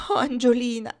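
a faltering, overwhelmed stammer. A narrator's reading. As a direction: blurt it, stumbling over the name. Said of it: "balbettò la sposina".

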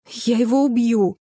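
indignant growl, mildly acted; growled threat. A narrator's reading. Heroine vows revenge on her boss, growling. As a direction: growl it through the anger. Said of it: "прорычала, сжав руки в кулаки".

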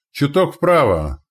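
high, loud, drawn-out call; shouted command. A narrator's reading. Gunner corrects the aim mid-battle, shouting. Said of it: "крикнул тот".

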